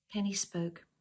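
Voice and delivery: ordinary delivery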